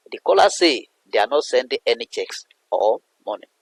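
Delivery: normal talking voice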